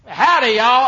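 loud, high hearty howdy; Texan greeting. Retold or imitated. Preacher to congregation, a loud mock Texan howdy. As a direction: greet strangers like a cowboy. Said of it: "I gave up on the Japanese accent".

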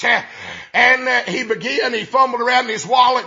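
normal talking voice

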